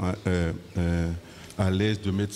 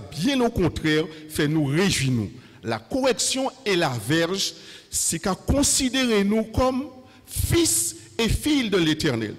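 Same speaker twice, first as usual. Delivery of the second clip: preaching with fervor; 6 semitones higher than usual. Acted. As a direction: build the sermon to a climax. Preacher rising to full force.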